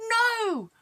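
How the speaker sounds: high, loud protest; head refusing the miller, high and loud; Jack's head. A narrator's reading. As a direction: cry out as the head. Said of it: "The head said".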